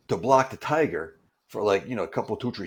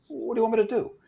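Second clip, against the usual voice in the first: incredulous; 12 semitones higher, much faster than usual. Retold or imitated. Incredulous reply to coordinator.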